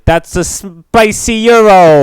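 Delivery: hammed-up Greek accent, slow and loud; loud, slow mock Greek accent. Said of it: "But in your best Greek accent".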